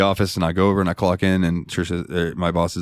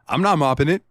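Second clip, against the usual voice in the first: indignant refusal; 7 semitones higher, faster than usual. Retold or imitated. Indignant rant refusing to mop.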